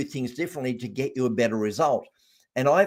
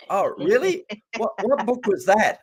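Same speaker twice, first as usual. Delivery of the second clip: higher, playing dumb about the book; fake-innocent "oh really?"; teasing his wife with pretend surprise; mock innocent surprise; 8 semitones higher than usual. Feigning ignorance to his wife.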